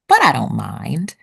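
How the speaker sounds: usual speaking voice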